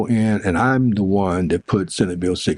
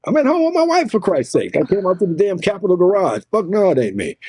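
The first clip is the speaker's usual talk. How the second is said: high-pitched outraged denial; exasperated, emphatic retort; indignant protest; 11 semitones higher, faster than usual. Retold or imitated. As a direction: protest innocence, voice jumping up. Protests TV clip is not him.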